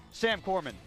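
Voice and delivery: ordinary delivery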